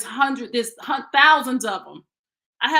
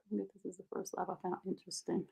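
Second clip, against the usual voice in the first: usual speaking voice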